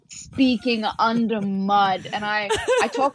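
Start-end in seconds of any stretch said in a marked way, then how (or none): 0.1-2.1 slow, dragged-out words; under mud. Shown as a demonstration. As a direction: speak as if wading through mud. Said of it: "it's like under mud".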